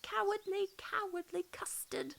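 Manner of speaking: high, mocking schoolboy taunt; taunting call. A narrator's reading. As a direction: jeer it at the running sheep. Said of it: "calls them out tauntingly".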